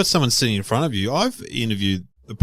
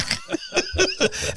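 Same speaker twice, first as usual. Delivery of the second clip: a burst of high laughter; high squeal of laughter; 4 dB quieter than usual. Real, not acted. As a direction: laugh it out mid story. Laughs mid story.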